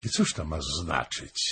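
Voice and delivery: understated disdain, pitched low; scornful. A narrator's reading. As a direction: answer with quiet contempt. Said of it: "pogardliwie odpowiedziała Chojmowa".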